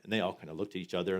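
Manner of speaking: normal talking voice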